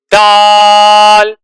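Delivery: slow, stretched letter name; drawn-out letter name. Shown as a demonstration. Teacher drawing out the letter name for students. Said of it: "اب دال کی آواز بنانی ہے".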